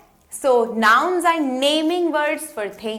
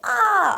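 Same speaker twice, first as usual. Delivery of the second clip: short high mock scream "aah"; a quick playful scream imitation to show the picture; teacher imitating a screaming girl in a short high squeal; 9 semitones higher than usual. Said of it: "चिल्ला रही है".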